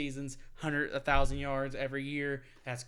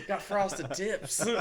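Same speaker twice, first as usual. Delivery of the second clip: laughing joke; 5 semitones higher, much slower than usual. Real, not acted. Joking about hair.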